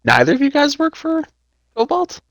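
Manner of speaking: surprised. Acted. Character hears surprising answer.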